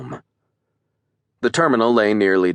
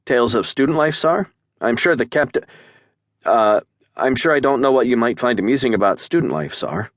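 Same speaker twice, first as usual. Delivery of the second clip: higher, stumbling cadet voice; flustered cadet; 6 semitones higher, 3 dB louder than usual. A narrator's reading. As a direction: caught off guard, scrambling for words.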